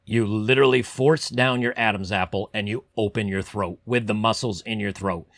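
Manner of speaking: ordinary delivery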